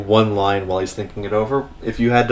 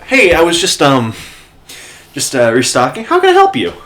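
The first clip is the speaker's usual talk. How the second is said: loud, falsely bright cover-up, last words much higher; forced cheer; 6 semitones higher, 6 dB louder than usual. Acted. Bats covering his crying for the knight, fake cheerful. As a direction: hide the tears behind fake cheer. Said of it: "And go".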